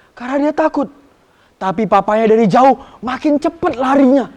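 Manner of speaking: put-on lively, loud storytelling for kids; storytelling narration. A narrator's reading. Sunday school teacher telling children a story, animated and loud. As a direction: tell the story with big rising drama.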